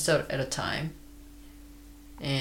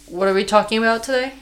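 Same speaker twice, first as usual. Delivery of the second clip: higher, louder, fast; bright host cue; 7 semitones higher, 6 dB louder, much faster than usual. Real, not acted. Host cheerfully launching today's topic, high and fast. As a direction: toss the question with energy.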